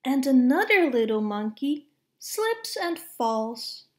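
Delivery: ordinary delivery, nothing marked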